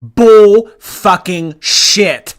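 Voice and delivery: loud, very slow, word by word; emphatic disbelief. Real, not acted. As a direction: call it out loudly, stretching every word. Rejects caller's answer on the clip.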